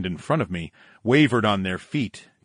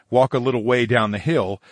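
plain reading voice

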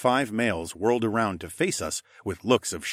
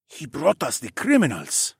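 higher-voiced councilman; quietly insistent; 6 semitones higher than usual. A narrator's reading. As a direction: insist to colleagues in a huddle. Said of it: "one of the men insisted quietly".

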